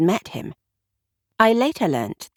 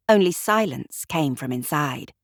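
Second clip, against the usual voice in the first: ordinary delivery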